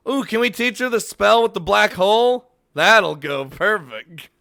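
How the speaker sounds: high, loud and eager; excited. Real, not acted. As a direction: blurt the idea out eagerly. Excited suggestion.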